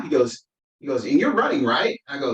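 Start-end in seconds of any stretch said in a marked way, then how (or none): 1.0-2.0 lightly voiced child, higher questioning lift; a hint of an eager little boy checking; young son. Son checking that dad is running, lightly voiced child.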